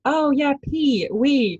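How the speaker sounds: high, slow and dainty, minding her words; prim polite version. Retold or imitated. Showing how she spoke politely instead of swearing, prim and high. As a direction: put on a prissy voice to avoid swearing. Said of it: "'cause I was trying not to be like".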